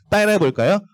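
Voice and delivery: usual speaking voice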